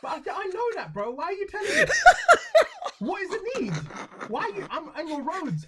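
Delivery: high, indignant rant that builds to a laughing shout; exasperated rant. Real, not acted. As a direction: rant at the signs like they insulted you. Ranting at French road signs.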